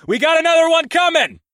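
very high, loud alarm; shouting warning. A narrator's reading. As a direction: yell the warning.

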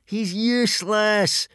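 high, loud, drawn-out jeer; gleeful crowing. A narrator's reading. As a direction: crow it with glee, stretching the word. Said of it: "crowed Snotlout gleefully".

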